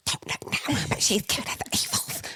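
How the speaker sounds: hushed mock whisper. To her friend about the grumpy woman, a whisper. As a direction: whisper a warning behind her back.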